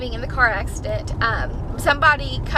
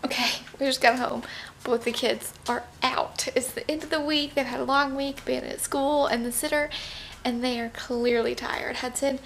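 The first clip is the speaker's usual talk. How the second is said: hushed voice; 9 dB quieter than usual. Real, not acted. Kids asleep in the car.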